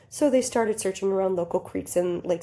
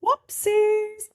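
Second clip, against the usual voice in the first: high, sing-song whoopsies; playful; 12 semitones higher, 3 dB louder than usual. Real, not acted. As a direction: chirp it out brightly. After mic mishap.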